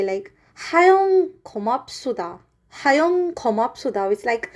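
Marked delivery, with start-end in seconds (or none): 0.5-2.4 Jeju dialect. Shown as a demonstration. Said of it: "And we also say". 2.7-4.1 Jeju dialect. Shown as a demonstration. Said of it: "And we also say".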